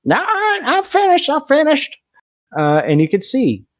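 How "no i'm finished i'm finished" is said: very high, slow whiny voice; squeaky frantic character refusing; panicked refusal. Acted. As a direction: wail that you're done.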